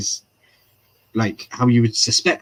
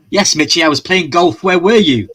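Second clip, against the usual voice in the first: mock king voice; 7 semitones higher, 8 dB louder than usual. Mock king scolding Mitchie.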